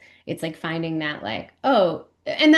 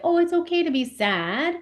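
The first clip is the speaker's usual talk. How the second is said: high, soothing parent reassurance; gentle parent voice; 10 semitones higher than usual. Retold or imitated. Parent soothing a sad child, high and gentle. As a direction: coo it like a parent comforting a child. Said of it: "told with our parents' voices".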